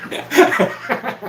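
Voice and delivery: breaking up into laughter on the word; high helpless laugh cutting the sentence off. Real, not acted. Breaks up laughing at own rant.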